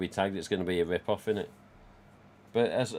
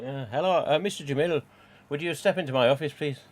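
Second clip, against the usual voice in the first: hammed-up Irish accent, higher and quick; jokey Irish accent, like a boss summoning Jamil; bad Irish accent; 5 semitones higher than usual. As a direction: put on a cheesy Irish accent to call him in. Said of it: "It's a bad Irish accent".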